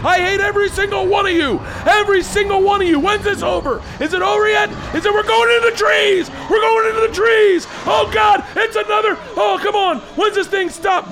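very high, loud, hammed-up terror; shrieking comic rant, relentless; dad screaming in panic. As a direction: imitate a dad cursing through a thrill ride. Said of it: "that's my dad for the whole fucking ride".

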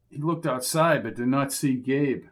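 ordinary talk, nothing marked

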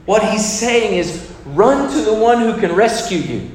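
preaching at growing force; building preaching. Real, not acted. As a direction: build it up to a plea.

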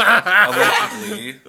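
loud, high, drawn-out word through laughter; laughing aside. Real, not acted. Hedging a fact to laughing co-hosts, amused shout. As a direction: stretch it out loudly while laughing. Joking hedge on the birthday, laughing.

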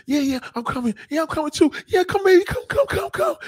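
very high, rising hammed-up moans; mock climax moaning. Woman coming with her man, hammed-up high moaning. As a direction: build to a breathy climax. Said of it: "the woman's like".

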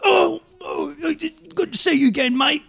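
high, strained mock-British shout; hammed-up, overwrought action-hero greeting; Jason Statham. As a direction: cry it out in a mock British accent. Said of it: "And then Jason Statham's like".

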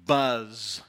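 low, drawn-out buzz like a bee; buzzing sound word. Shown as a demonstration. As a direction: make it sound like the bee it names. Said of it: "Let me give you an example".

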